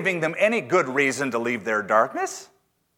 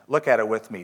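ordinary delivery

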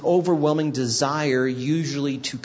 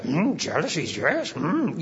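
croaky wise alien mumble; lightly voiced Yoda; 3 semitones higher than usual. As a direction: mimic a wise old puppet master. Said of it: "It sounds like Yoda".

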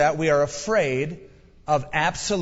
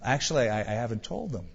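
sheepish mumble; 4 semitones lower, 6 dB quieter than usual. Retold or imitated. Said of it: "He goes".

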